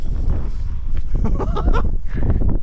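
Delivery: squealing wheeze of a laugh; high breathless laugh. Real, not acted. Watching the dog, squealing laugh. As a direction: crack up helplessly.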